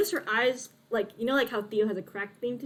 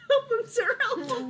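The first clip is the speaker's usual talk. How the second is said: high, mocking echo of a pleading boy; mimicked plea; 13 semitones higher, slower than usual. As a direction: parrot the plea in a squeaky-high voice. Echoes the boy's plea, laughing.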